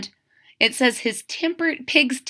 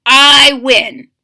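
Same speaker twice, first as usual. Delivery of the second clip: 1 loud gloating; 10 dB louder than usual. Shown as a demonstration. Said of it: "What would you say to someone".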